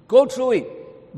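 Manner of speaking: slow, high and loud, almost shouted; thundering command. Acted. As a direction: boom it out like God commanding. God's command, preached emphatically.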